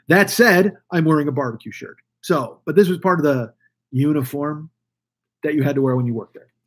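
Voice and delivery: ordinary delivery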